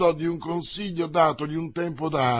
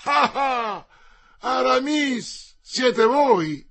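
higher, loud, stretched-out name; amazed recognition; 9 semitones higher, 6 dB louder, much slower than usual. Acted. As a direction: Porthos startled to find his friend. Porthos recognises Aramis, amazed.

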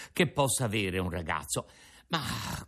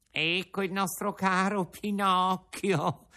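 slow, higher, honeyed fox's cry; sly fox greeting; 8 semitones higher than usual. A narrator's reading. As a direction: greet him with false affection. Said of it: "gridò la volpe abbracciandolo e baciandolo".

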